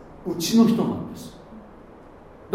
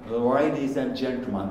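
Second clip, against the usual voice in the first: airline announcement; 4 semitones lower, 3 dB quieter, much slower than usual. Retold or imitated. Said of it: "アナウンス、飛行機".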